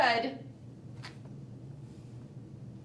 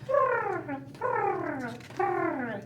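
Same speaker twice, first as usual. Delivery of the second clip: kitten purr; 4 semitones higher, much slower than usual. Acted. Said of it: "And the kittens say".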